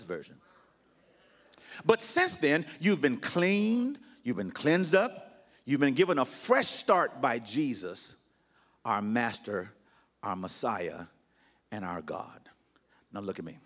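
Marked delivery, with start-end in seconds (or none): 1.8-12.5 measured pulpit voice fading to a hush; scripture reading. Acted. Reads The Message aloud.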